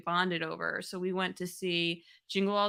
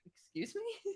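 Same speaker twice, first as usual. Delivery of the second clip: high, soft puzzled question; confused, lightly voiced dumb henchman; perplexed Bane; 12 semitones higher, 8 dB quieter, slower than usual.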